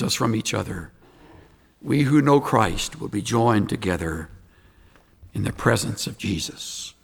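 hushed, gentle altar call. Real, not acted. Soft closing prayer invitation.

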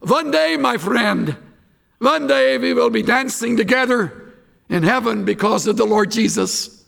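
put-on light Dutch accent, v for w; lightly imitating a thick Dutch accent. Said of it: "announced in her thick Dutch accent".

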